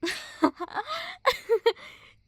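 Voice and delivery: loud, high scornful burst of laughter; a cruel cackle at their naivety; mocking laugh. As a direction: laugh right in their face. Bully laughs at the idea.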